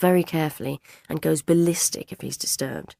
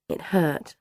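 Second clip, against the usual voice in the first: ordinary delivery, nothing marked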